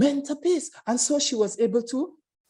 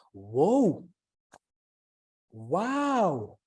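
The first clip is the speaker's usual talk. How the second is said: surprised; 5 semitones lower than usual. Acted. Reacting to her return.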